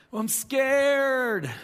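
put-on high, slow, whimpering child voice; scared little boy. Dad voicing his scared son going downstairs, high and whiny. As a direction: play the frightened six-year-old.

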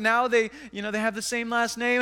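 ordinary talk

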